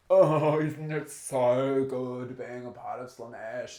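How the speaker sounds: a loud swooning "Oh", then slow and dreamy; lounging partygoer savouring the excess; blissed-out Slaanesh reveller. Retold or imitated. Mocking Slaanesh revellers at their parties, loud and languid. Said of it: "and they're like".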